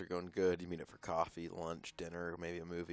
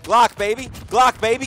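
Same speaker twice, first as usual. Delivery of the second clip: loud repeated chant; loud brag; 8 semitones higher, 14 dB louder than usual. Real, not acted. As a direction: chant it proudly.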